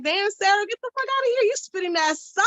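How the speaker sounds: high, hyped-up surprise at herself; a high, amazed pat on her own back; impressed self-praise. Retold or imitated. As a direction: cheer yourself on, way up high. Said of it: "So I was like".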